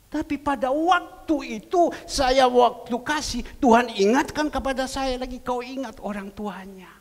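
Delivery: loud, high impassioned sermon voice; preaching at full force. Acted. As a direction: preach it out to the congregation. (berkhotbah dengan suara keras dan berapi-api)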